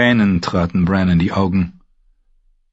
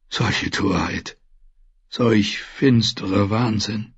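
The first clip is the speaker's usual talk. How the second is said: weak dying maester; 3 semitones higher than usual. Acted. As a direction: push the words out with effort. Dying maester lists the dead.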